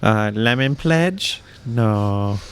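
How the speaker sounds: lightly voiced maid, slow and drawn out; Consuela impression. Friend mimics a maid answering the phone, slow mock voice. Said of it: "Everybody needs a Consuela".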